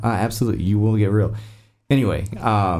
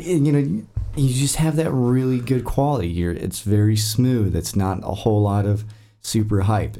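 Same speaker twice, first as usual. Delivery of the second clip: ordinary talk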